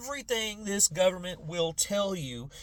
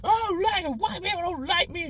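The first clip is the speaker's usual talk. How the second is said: much higher whiny mock voice; his younger angry self; 14 semitones higher than usual. Mocking his younger self, high and whiny. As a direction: whine like a self-pitying kid. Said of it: "I was the guy".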